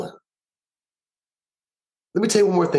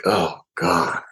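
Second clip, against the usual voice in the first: awed gasp; 7 semitones lower than usual. Retold or imitated. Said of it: "I'm like".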